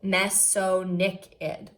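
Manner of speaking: Mesonychid said slowly, syllable by syllable: me-so-NY-chid; careful pronunciation. Shown as a demonstration. Teacher reading aloud to students, sounding out Mesonychid slowly. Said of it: "it even tells me how to pronounce that really tricky word".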